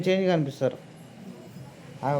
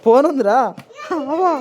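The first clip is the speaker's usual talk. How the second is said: laughing; 10 semitones higher, 7 dB louder than usual. Real, not acted. Laughs at child.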